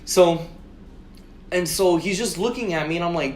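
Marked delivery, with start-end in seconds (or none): none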